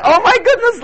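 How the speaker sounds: high, loud exclamation of amused surprise; surprised laugh. Real, not acted. Pastor amused that one person saw the show, exclaiming high. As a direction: burst out in delighted shock.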